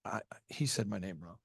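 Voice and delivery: hurt bride. Retold or imitated. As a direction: imitate a heartbroken bride. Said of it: "she would come up to us and say".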